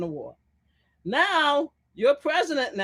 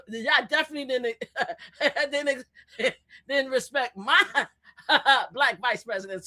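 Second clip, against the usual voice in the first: laughing while talking. Real, not acted. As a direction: laugh breaking up the words. Laughing at her own jab mid-rant.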